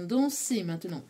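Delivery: ordinary delivery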